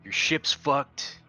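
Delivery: ordinary talk, nothing marked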